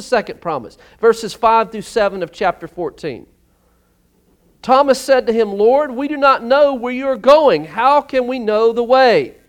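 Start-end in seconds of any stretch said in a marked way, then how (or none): 4.6-9.4 raised, loud scripture reading; preaching at full force. Acted. Preacher reading Thomas's question to the church, loud and raised. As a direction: proclaim Thomas's question to the congregation.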